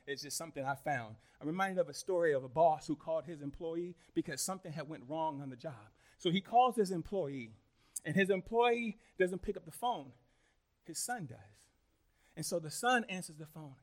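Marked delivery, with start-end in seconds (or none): none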